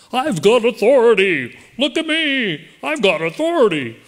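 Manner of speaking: sneering parody of a self-appointed mask enforcer; mocking bossy official. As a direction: ham up a petty tyrant. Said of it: "I hate that when people do that".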